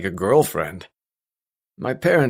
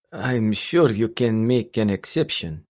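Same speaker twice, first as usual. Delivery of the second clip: put-on slow, persuasive Russian accent. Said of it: "A heavy Russian accent came over the line".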